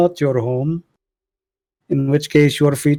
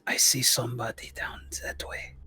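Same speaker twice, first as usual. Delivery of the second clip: hushed whisper at a spotted stranger; 12 dB quieter than usual. Real, not acted. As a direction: whisper so they don't hear. Spotting someone, sneaking.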